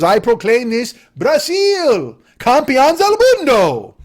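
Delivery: slow, booming mock Latin accent; put-on foreign accent. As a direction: proclaim it grandly in a fake Latin accent. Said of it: "spoke in that accent".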